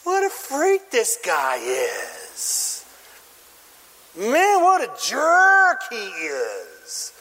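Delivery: slow, drawn-out disbelief at the man; mock disgust. Acting out disgusted audience reaction.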